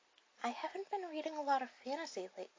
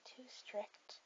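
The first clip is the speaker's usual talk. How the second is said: slow confiding whisper; 8 dB quieter than usual. Acted. Girl whispers to her date about her strict parents. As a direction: whisper it like a secret, slowly.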